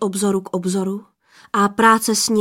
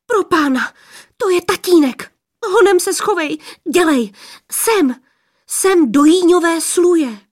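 much higher, louder frightened hurry; panicked urging; 8 semitones higher, 6 dB louder than usual. Acted. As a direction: hide him, quick, alarm in the voice. Sisters hide boy from father. (sestry v panice schovávají Adama před otcem, vyděšeně a hlasitě)

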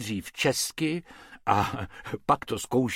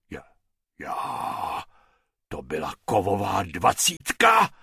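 low, rough street musician; a gruff old organ-grinder asking about the coin; 4 semitones lower than usual. Acted. Musician asks if it was a coin, gruff.

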